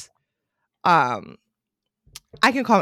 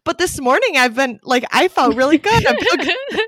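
pitched up, insisting brightly; insisting she feels good; 8 semitones higher, 5 dB louder than usual. Retold or imitated. Retelling to Chris after breakdowns, pitched-up cheerful insisting. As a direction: talks herself up, higher and higher. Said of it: "I was like".

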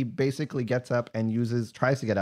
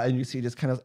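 ordinary delivery

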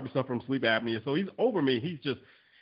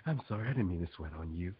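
soft, hushed apologetic masseur; mock the timid masseur's soothing whisper-soft apology; massage voice; 6 semitones lower, 6 dB quieter than usual. Client mimicking the sweaty masseur's soft apology, hushed. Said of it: "stop using the massage voice".

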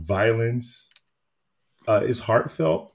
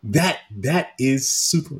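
slow, drawn-out awe; slow, higher, louder delight that eases off; awed; 5 semitones higher, 5 dB louder, slower than usual. Retold or imitated. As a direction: savor the words in wonder. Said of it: "And I'm like".

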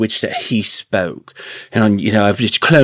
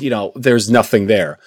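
usual speaking voice

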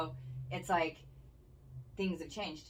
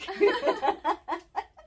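shrieking giggle; high laugh; 14 semitones higher, 8 dB louder than usual. Real, not acted. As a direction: squeal with laughter. Cracking up again.

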